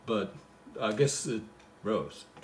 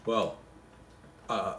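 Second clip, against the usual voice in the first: ordinary talk, nothing marked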